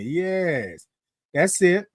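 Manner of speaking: usual speaking voice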